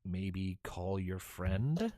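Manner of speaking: low, soft, very slow suggestion; gentle nudging. Retold or imitated. Game master nudges stuck novice players, slow hinting. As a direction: draw it out into a tentative rising question. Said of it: "nudging them with suggestions like".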